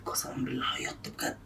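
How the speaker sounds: barely audible voiceless whisper; secretive whisper; whispered aside. Real, not acted. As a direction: whisper it so only the camera hears. Same aside repeated in whisper.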